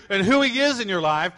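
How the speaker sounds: ordinary talk, nothing marked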